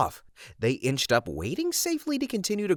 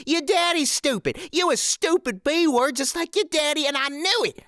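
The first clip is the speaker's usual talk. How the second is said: ranting shrew; 8 semitones higher than usual. Retold or imitated. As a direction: tear into him like a nasty shrew. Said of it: "stream of hate and filth".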